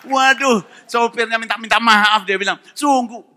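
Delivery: loud, high testimony cry; loud, soaring pulpit voice; full-force preaching. Acted. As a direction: preach it out to the whole hall.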